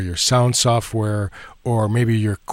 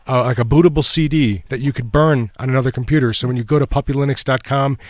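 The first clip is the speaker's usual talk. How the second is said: normal talking voice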